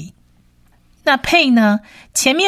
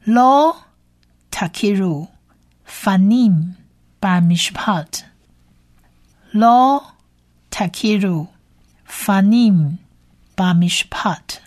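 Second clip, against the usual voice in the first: Hebrew verse read word by word with long pauses; measured language-teacher model reading; careful recitation; faster than usual. Shown as a demonstration. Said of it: "我们来把这一节经文呢多念几次".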